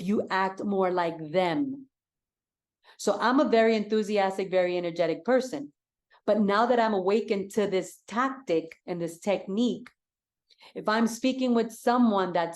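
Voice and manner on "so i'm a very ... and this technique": normal talking voice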